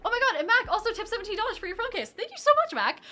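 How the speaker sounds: high, loud burst of gratitude; excited thanks. Real, not acted. Streamer thanking a viewer for a big tip, excited. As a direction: gush with real surprise and delight.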